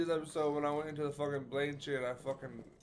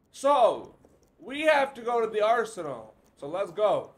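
excited shout; 7 semitones higher, 8 dB louder than usual. Real, not acted. Excited shouted gameplay commentary.

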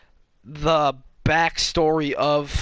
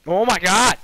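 sudden loud, higher exclamation; startled outburst; 5 semitones higher, 7 dB louder, slower than usual. Real, not acted. Gamer interrupted mid-story by the game, startled outburst. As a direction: burst out mid-sentence at the game.

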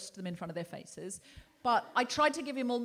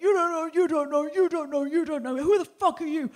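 higher, louder mock yelling; furious man; 7 semitones higher, 10 dB louder than usual. Imitating the man yelling at her on the train, loud and high. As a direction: shout her down in a hammed-up rage. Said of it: "he was like".

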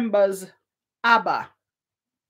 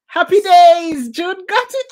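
real excited cheer; 9 semitones higher, 11 dB louder than usual. Real, not acted. Quiz host to June, who got the answer: excited cheer. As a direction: cheer the winner loudly, stretching the words.

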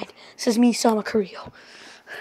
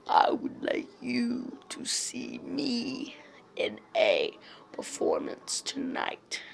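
whispering; 13 semitones lower, 6 dB quieter than usual. Pretend. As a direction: breathe it like a secret invitation. Inviting audience in hushed voice.

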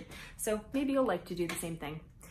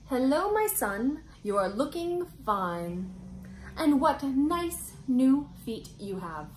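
higher, louder, slower happy mother voice; mother mouse; 6 semitones higher, 4 dB louder, slower than usual. Acted. Mother mouse greeting her son, higher and slower with delight.

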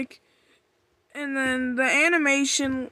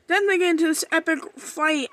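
rushing it out, x1.5; racing through the recap; excited fast talk; 3 semitones higher, faster than usual. Real, not acted. Fan rushing to tell viewers the epic fight, fast. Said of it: "I'm sorry if I'm talking fast".

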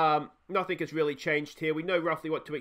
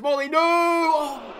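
excited yell; 10 semitones higher, 8 dB louder, much slower than usual. Real, not acted. Watching a big chance, excited.

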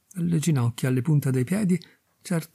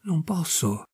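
soft, a touch of crying in the voice; softer, mildly tearful refusal; 3 semitones higher, 3 dB quieter, slower than usual. A narrator's reading. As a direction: repeat it quietly through tears. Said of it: "Lei cominciò a piangere e ripeté".

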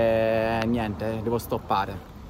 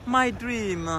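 gushing; 9 semitones higher, much slower than usual. Real, not acted. Gushing over Miami.

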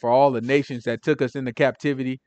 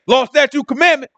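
excited approval; 8 semitones higher, 4 dB louder than usual. Retold or imitated. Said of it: "They gonna be like".

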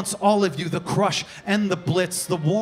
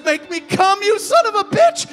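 very high, loud scream; peak, screamed demand; 12 semitones higher, 7 dB louder than usual. Acted. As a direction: scream it at the top of the climb.

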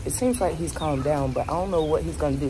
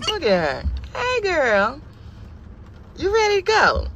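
much higher sing-song pet voice; doggy baby talk; 9 semitones higher than usual. Acted. Owner praising her dressed-up dog, high cooing baby talk.

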